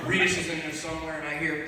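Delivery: ordinary talk, nothing marked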